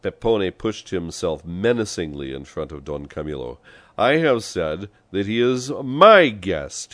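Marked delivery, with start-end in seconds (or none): 4.0-6.9 loud, slow, high insistence; forceful raised-voice repetition; fierce. A narrator's reading. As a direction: hammer out each word fiercely. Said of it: "he repeated fiercely".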